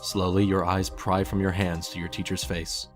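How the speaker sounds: ordinary delivery, nothing marked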